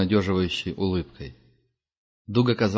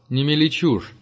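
irritated; 7 semitones higher, 4 dB louder than usual. Acted. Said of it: "раздраженно бросил Герман".

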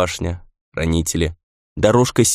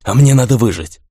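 loud hoarse insistence; defiant, raised rasp; hoarse retort; 2 semitones higher, 5 dB louder than usual. A narrator's reading. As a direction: force it out through a squeezed throat. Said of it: "прохрипел Рэй".

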